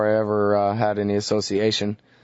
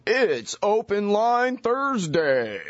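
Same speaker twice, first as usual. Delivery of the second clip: slow, high, put-on announcer voice; mock radio professional read; 11 semitones higher, much slower than usual. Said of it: "I should try to sound like a radio professional".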